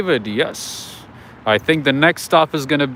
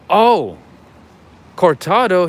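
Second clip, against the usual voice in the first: higher, louder barista voice; mimicking the barista; 7 semitones higher, 6 dB louder, slower than usual. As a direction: quote the barista, pitched up. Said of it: "He goes".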